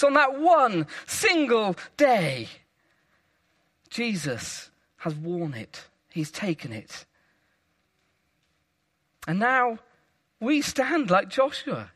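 usual speaking voice

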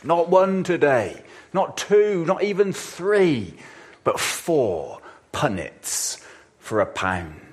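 high, loud market-stall cry, slowing at the end; market trader bellow. Retold or imitated. Preacher imitating a market trader shouting his deals. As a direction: bellow it like a barrow boy selling punnets. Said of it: "traders are bellowing out their impossibly good deals".

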